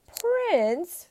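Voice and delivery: very high, loud incredulous shriek. A narrator's reading. Emperor scandalised by peasant's claim, shrieking. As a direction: shriek it in outraged disbelief. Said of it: "shrieked the emperor".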